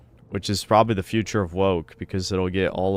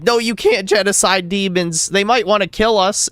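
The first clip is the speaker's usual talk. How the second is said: much higher, mocking pleading tone; mocking whiny voice; 11 semitones higher, 6 dB louder than usual. Streamer mocking people who defend fictional demons, high whiny parody. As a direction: parody an outraged online leftist. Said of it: "People unironically are like".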